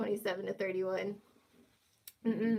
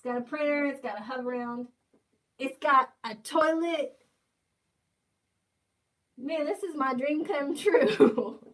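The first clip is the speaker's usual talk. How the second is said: gleeful; 4 semitones higher, 6 dB louder than usual. Real, not acted. Listing the unit's features in delight.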